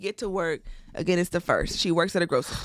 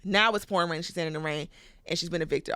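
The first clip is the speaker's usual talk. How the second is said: ordinary talk, nothing marked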